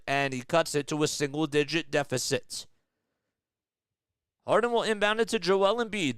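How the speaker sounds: lively sports announcer read; play-by-play commentary. Acted. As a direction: call the game like a broadcaster.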